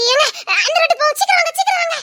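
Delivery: high, impatient hurry-up; bouncing with impatience; eager urging. Acted.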